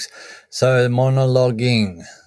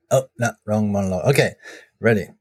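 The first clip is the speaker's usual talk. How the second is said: normal talking voice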